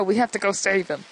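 ordinary delivery

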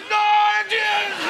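high loud strained grunt; old man grunt. Retold or imitated. Old man grunting as he gets out of a chair. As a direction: force it out rising from the chair. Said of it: "getting out of a chair".

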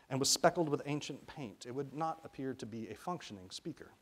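plain reading voice

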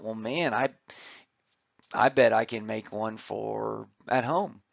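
usual speaking voice

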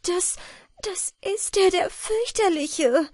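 fairy crying out as the monster comes; in bright terror. Acted. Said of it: "rief Wieglinde in heller Angst".

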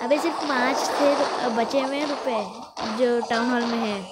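ordinary talk

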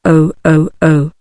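a hint of frightened ladies crying out; lightly voiced cry. Ladies startled by the camel, a mild frightened cry. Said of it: "cried the ladies in a chorus".

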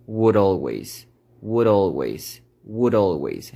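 "would always" with a tapped D, like "wurolweis"; slow linked "would always"; flap D linked. Shown as a demonstration. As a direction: tap the D into "always". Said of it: "vamos a conectarlo de la siguiente forma".

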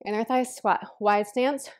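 ordinary talk

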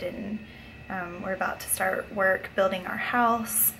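normal talking voice

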